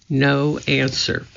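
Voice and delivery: ordinary delivery, nothing marked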